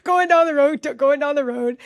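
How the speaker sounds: high, tense play-by-play, easing slightly; anxious retelling. Retold or imitated. Mom re-enacting a tense drive with her teen, anxious. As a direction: act out a nervous passenger. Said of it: "so heated and anxious".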